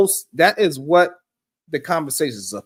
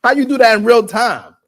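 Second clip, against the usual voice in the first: shocked quote; 7 semitones higher, 6 dB louder than usual. Retold or imitated. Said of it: "Ricky was like".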